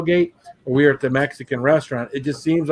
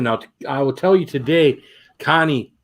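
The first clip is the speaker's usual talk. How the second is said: usual speaking voice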